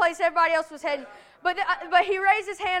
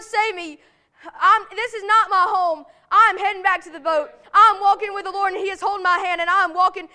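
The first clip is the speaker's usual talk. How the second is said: higher, driving preacher's voice; impassioned preaching; 3 semitones higher, 6 dB louder than usual. Acted. Preacher urging the congregation to call on the Lord, impassioned preaching. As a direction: preach it out with rising force.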